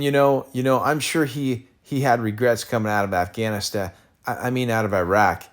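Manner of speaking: usual speaking voice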